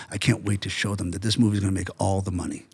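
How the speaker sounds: low, soft, confident boast; James Cameron. Retold or imitated. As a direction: quote him quietly, pitched well down. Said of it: "That's what he said".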